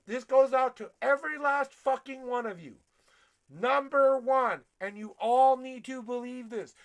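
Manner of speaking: loud, forceful preaching yell; fired-up sermon shout; yelling at the audience. Acted. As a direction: bark it at every listener. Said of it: "I'm gonna yell at you".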